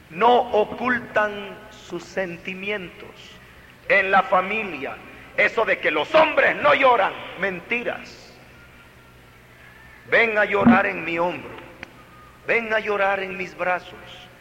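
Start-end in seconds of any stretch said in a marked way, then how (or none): none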